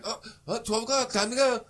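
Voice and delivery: mock sudden realization. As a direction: play the guy noticing the clock, hammed up. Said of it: "And, like".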